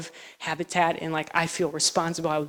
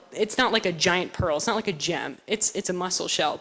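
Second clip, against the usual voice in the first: usual speaking voice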